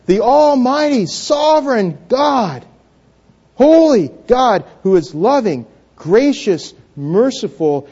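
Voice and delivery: high, ringing proclamation, each title set apart; emphatic preaching. Real, not acted. Preacher declaring who God is to the congregation, emphatic.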